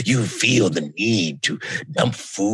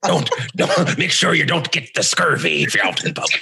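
pirate voice; 9 semitones higher, faster than usual. Acted. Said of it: "my pirate impersonation".

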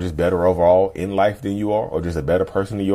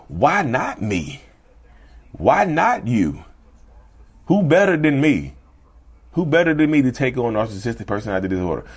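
Les Brown quote; 5 semitones higher than usual. Retold or imitated. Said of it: "And Les Brown said".

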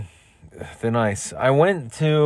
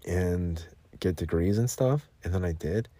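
ordinary delivery

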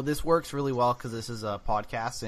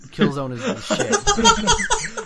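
laughing; 5 dB louder, much slower than usual. Real, not acted. Takes back insult, laughing.

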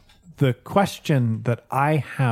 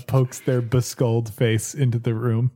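ordinary talk, nothing marked

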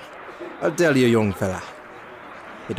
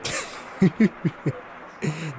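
hearty laugh; 7 semitones higher than usual. Acted. Old man laughs at answer.

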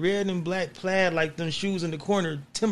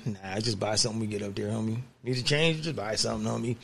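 low and softer, shrugging; casual homie voice; 9 semitones lower, 8 dB quieter than usual. Retold or imitated. To his friend about packing, low careless shrug. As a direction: brush it off, no big deal.

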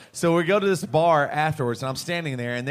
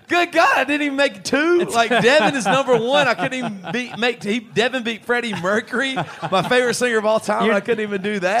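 mock outrage; 7 semitones higher, 5 dB louder than usual. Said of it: "I went".

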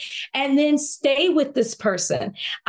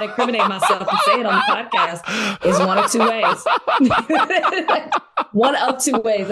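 talking through real laughter; laughing; 3 dB louder than usual. Real, not acted. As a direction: laugh while half-saying it. Laughing while dodging the question.

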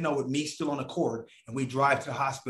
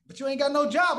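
much higher and louder taunt; mocking producer voice; 12 semitones higher, 4 dB louder than usual. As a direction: throw his joblessness at him. Producer voiced in retold call.

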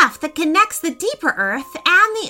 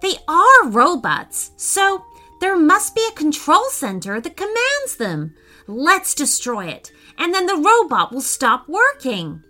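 put-on higher-pitched, lively boy's explanation; explaining; 7 semitones higher than usual. A narrator's reading. Preston explains his plan to destroy the robot, explaining. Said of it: "explained Preston".

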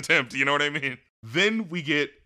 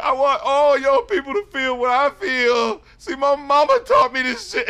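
mock rapper ramble; 9 semitones higher, 6 dB louder than usual. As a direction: ham up a drunk rapper preaching to the crowd.

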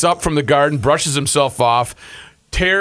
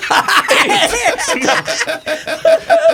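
loud laughter; 4 dB louder than usual. Real, not acted. Laughing at his story.

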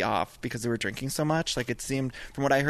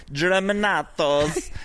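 Germanatos drawn out slow, higher and louder, Italian; 6 semitones higher, 5 dB louder, much slower than usual. Retold or imitated. Guest imitates [name] saying her name in an Italian accent. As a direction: roll out Germanatos like a proud Italian. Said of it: "her whole voice changed".

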